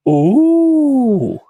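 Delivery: high, mocking "Ooh" from the crowd. Pub onlookers jeering at the fight, high mocking ooh. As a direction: tease like gawping pubgoers.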